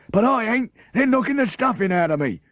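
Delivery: high, loud, strained crook's voice; gasping Bert. Acted. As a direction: wheeze it out from under the pile. Bert squashed under the children.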